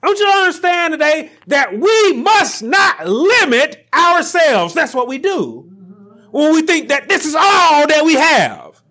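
rising high and loud to a shout; preaching shout. Acted. Preacher urging the congregation not to limit themselves, shouting. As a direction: preach it at full force.

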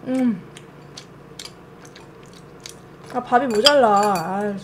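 chewing while talking; eating and speaking at once; mouth full. Real, not acted. Seen 1: Bite at 3.0; chewing puffed cheeks while saying 음 at 4.2.

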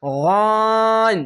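teacher lengthening গইন slowly; drawn-out Arabic letter. Shown as a demonstration. Teacher demonstrating the long vowel, drawn out. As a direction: stretch গইন long, three alif. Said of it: "এখানেও তিন আলিফ টেনে পড়বেন".

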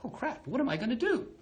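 high, worried rabbit voice; a rabbit stuck at the lake, fretting; a touch of high-pitched panic; panicked rabbit. Retold or imitated. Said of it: "and he's just like".

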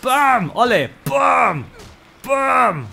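high, loud shouted blasts; battle shout. Real, not acted. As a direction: yell with each shot. Shouting while shooting.

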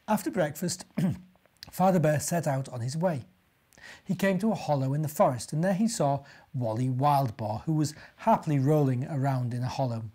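plain reading voice

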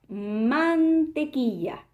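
"mantequilla" drawn out slowly; stretched reveal. Shown as a demonstration. Teacher revealing the answer word slowly. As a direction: stretch "mantequilla" so kids hear each syllable. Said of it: "un objeto que comienza con la sílaba ma es".

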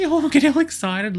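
high, loud delight with a laugh; excited. Real, not acted. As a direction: squeal it with a giggle. Sims finally getting along.

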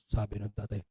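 usual speaking voice

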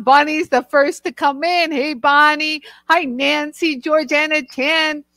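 usual speaking voice

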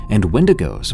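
plain reading voice

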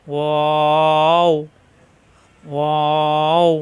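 লাম and মিম held very long and slow; drawn-out letter names. Shown as a demonstration. Teacher drawing out letter names for students to copy. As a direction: stretch each letter name out slowly. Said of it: "দুই ঠোঁট থেকে উচ্চারণ".

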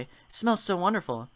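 ordinary talk

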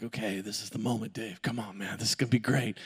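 a quiet mirror pep talk before the big moment; hushed self pep talk. Retold or imitated.

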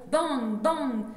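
two loud bangs, each falling; imitated gunshots. Poet voicing cowboys shooting from horseback, loud mock gunfire. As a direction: fire the bangs off like shots.

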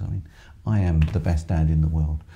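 ordinary talk, nothing marked

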